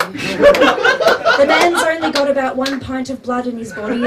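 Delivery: normal talking voice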